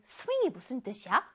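usual speaking voice

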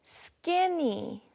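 slow, stretched-out "skinny"; pronunciation model. Shown as a demonstration. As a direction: draw out "skinny" for learners to copy. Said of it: "Let's practice our pronunciation".

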